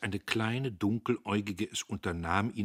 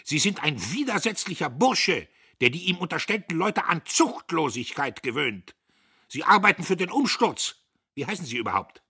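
high, loud denunciation; furious boss berating a subordinate; unrestrained anger; 10 semitones higher, 11 dB louder than usual. A narrator's reading. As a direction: shout charges, then demand the name. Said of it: "war nicht länger zu halten".

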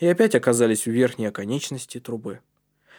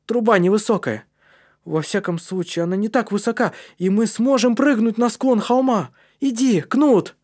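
high, loud, building call; rally your friend to go; eager shout rising in force; excited urging; 9 semitones higher, 4 dB louder than usual. A narrator's reading. Калиб возбуждённо зовёт товарища прыгать.

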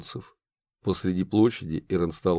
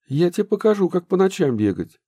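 scolding mother; 10 semitones higher, 3 dB louder than usual. Acted. Angry mother scolding daughter.

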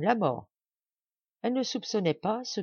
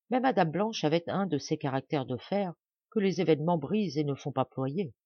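plain reading voice

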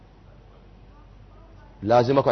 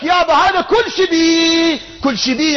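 raised voice hammering the point home; exasperated insistence; 12 semitones higher, 5 dB louder than usual. Acted. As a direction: insist loudly, pitched high, to unresponsive listeners. Exasperated repeat of the example.

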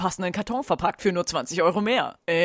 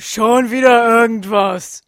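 booming title read slowly; loud slow title; 3 semitones higher, 9 dB louder, slower than usual. Acted. Narrator booms the silly subtitle. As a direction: proclaim it like an epic title.

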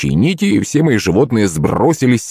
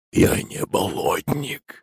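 slow, soft, bubbling creature voice; gurgling monster voice; 7 dB quieter, much slower than usual. A narrator's reading. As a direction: gurgle each word like a swamp thing. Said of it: "забулькало существо".

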